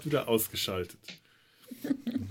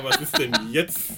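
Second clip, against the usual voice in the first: ordinary delivery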